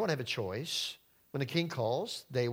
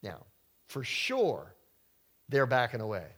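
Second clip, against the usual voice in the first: normal talking voice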